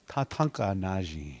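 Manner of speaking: Tatanka Naji spoken low with a falling end; Lakota phrase. Shown as a demonstration. Teacher modelling Lakota for learners, low and clear. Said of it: "Buffalo standing".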